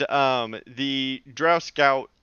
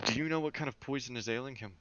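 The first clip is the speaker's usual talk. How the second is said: soft, quick murmured question; barely audible in-character question; too-quiet character line; 11 dB quieter, faster than usual. Acted. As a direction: ask it under your breath, hurried. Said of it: "can you speak up".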